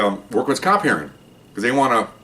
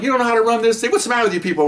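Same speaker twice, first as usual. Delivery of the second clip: pitched-up scornful scold; scolding old-timer; 11 semitones higher, much faster than usual. Retold or imitated. Old supervisor scolding the cops over the gate, mock scorn. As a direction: scoff at the clueless cops.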